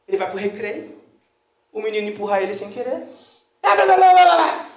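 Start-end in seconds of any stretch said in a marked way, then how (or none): 3.6-4.7 loud, higher mock tirade of curses; hammed-up shouting of curse words in place of real ones; mock furious shouting. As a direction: yell nonsense insults like an angry parent. Parent cursing at the school.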